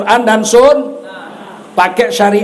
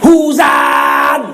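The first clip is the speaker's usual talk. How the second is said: blunt, shouted Batak-style singing; Batak accent; 3 semitones higher than usual. Shown as a demonstration. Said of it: "nyanyinya lagu Batak".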